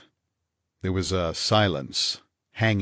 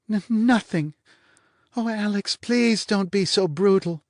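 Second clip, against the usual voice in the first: woman's pleading voice; 12 semitones higher than usual. Acted. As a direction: beg him not to be brutal.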